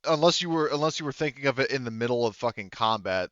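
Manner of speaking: ordinary talk, nothing marked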